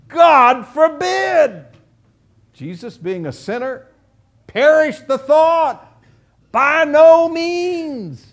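high, loud and slow, each phrase drawn out in horror; emphatic outcry. Acted. Preacher voicing Paul's horrified refusal, loud and drawn out. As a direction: cry it out as if the very idea is unthinkable. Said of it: "Very emphatic, dogmatic statement".

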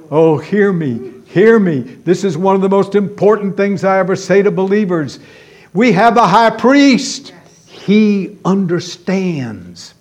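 ringing pulpit exhortation; full-force preaching. Real, not acted. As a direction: preach it out with urgency to the believers.

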